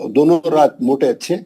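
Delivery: ordinary talk, nothing marked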